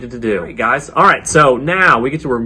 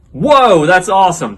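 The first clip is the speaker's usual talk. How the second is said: big, high, loud whoa of delight; excited cheer; 4 semitones higher, 4 dB louder than usual. Real, not acted. Children's leader praising kids' shouted answers, excited. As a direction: react like you're thrilled by their answers. Reacting to kids yelling answers.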